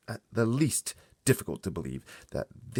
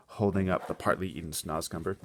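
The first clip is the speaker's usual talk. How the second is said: ordinary delivery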